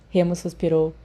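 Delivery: plain reading voice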